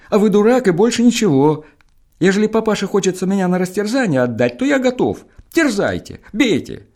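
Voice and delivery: cheeky and unafraid; defiant son. Acted. Сын дерзит жандарму. (выше, дерзко отвечает жандарму)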